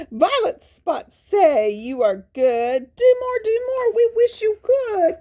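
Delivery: very high, excited show-off voice; the dog showing off his violet spots; proud Spot. Acted. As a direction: call out high and eager.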